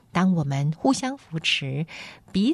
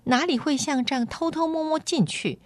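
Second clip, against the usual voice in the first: haughty, indignant complaint, pitched high; mildly fierce storybook girl, voice swooping up; fierce retort; 7 semitones higher than usual. A narrator's reading. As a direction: complain crossly as a noble girl. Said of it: "但口气很凶".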